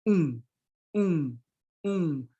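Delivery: um as nasalized u, lips not closed; nasal u. Shown as a demonstration. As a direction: nasalize the u without the m. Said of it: "you don't close the lip".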